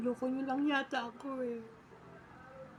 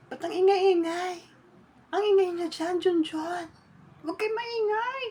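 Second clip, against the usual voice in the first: scolding shout; 6 semitones higher, 6 dB louder than usual. Real, not acted. Scolding the noisy kids.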